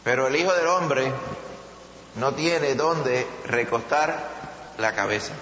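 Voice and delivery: usual speaking voice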